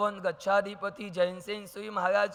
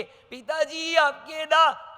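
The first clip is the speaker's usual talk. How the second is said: higher, slow drawn-out plea; son trying again; 7 semitones higher, 4 dB louder, much slower than usual. Acted. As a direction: stretch the words, begging to be heard. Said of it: "बेटा के". (धीमी, खिंची हुई बेटे की पुकार)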